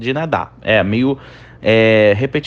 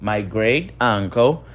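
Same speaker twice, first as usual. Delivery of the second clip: slow model reading; 2 semitones lower, slower than usual. Shown as a demonstration. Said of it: "Então, meu tio avô recentemente faleceu".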